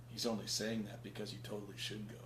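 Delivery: hushed, low conspiratorial aside; sly urging. Retold or imitated. Loki goading Thor to disobey Odin, sly urging. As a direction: drop it soft and low, imitating a schemer.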